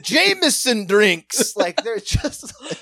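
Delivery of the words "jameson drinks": slow, loud, high fake British herald; mock British accent. Performer slowly reads the schedule to the audience, hammy British announcing. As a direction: proclaim it in a put-on British accent. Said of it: "Can you do a British accent?".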